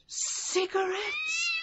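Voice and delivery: high, slow, delighted cry of triumph; gloating exclamation; gleeful discovery. Acted. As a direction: relish finding the perfect alibi. Villain spots the cigarettes.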